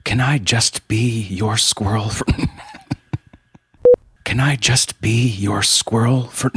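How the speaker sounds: cracking up mid-line; can't hold back the giggles; words through laughter; laughing through the line. Real, not acted.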